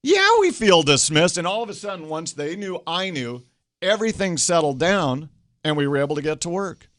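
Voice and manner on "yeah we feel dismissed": much higher, louder relieved shout; crowd shouting agreement. Retold or imitated. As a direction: yell back as the vindicated crowd. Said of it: "And it's like".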